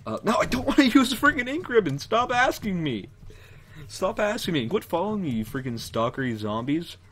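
high, loud annoyed yelling; fed up, yelling at the game; exasperated yelling. Real, not acted. Annoyed at game prompt and zombies.

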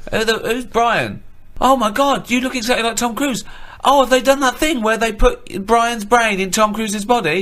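hammed-up disbelief at seeing Tom; mock astonished. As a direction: gasp at the lookalike. Plays studio boss meeting Brian.

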